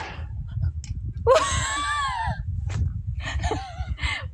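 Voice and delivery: high squealing laugh after a fright; shrieking laugh. Real, not acted. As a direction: laugh off the scare. Laughing after snow scare.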